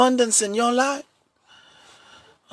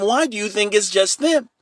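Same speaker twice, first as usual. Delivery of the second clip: ordinary talk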